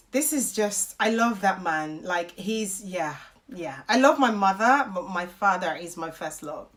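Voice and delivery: low, quiet, choked up with love; holding back tears. Real, not acted. Daughter confessing love for her father, close to tears. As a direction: speak softly and low, fighting tears. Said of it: "if I cry, you guys just take it".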